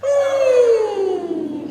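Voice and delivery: very high, loud head-voice ooh. Shown as a demonstration. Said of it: "A little bit higher".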